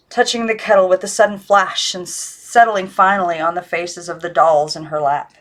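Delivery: ordinary delivery, nothing marked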